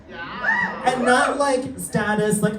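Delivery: emphatic shout. Real, not acted. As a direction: burst out to correct.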